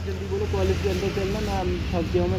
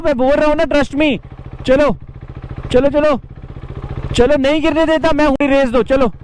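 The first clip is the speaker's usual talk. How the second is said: loud, higher, coaxing coach calls to a nervous rider; 6 semitones higher, 8 dB louder than usual. Real, not acted. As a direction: shout encouragement over the bike engine. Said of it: "कुछ नहीं होगा यार, डरो मत".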